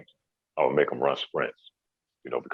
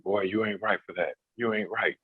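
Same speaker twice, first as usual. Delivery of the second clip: lightly voiced parent, pitched higher, chiding; a hint of a mom scolding in fun; teasing parent; 6 semitones higher than usual. Kobe's parents teasing him about the sprints, higher chiding voice. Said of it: "you hear his mom and dad saying".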